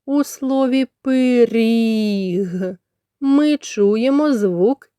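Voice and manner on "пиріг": "пиріг" with a long "і"; sounded-out word. Shown as a demonstration. Teacher picking out a vowel, drawn-out "пиріг". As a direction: stretch the last vowel of "пиріг". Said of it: "розібрати на звуки слово".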